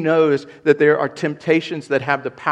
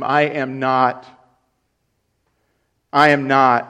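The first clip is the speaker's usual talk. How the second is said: angry denial; 6 dB louder than usual. Acted. Peter denying.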